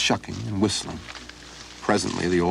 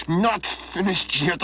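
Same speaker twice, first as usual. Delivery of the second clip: angry; 11 semitones higher, slower than usual. Acted. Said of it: "you sound angry".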